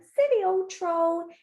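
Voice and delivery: high, slow little goat taunt; sing-out tease without shouting; teasing, not shouting. Acted. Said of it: "he's not shouting".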